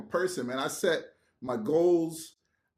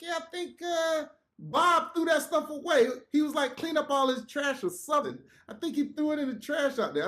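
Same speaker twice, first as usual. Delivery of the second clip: coworker passing on news; 10 semitones higher than usual. Retold or imitated. Voicing coworker breaking the news.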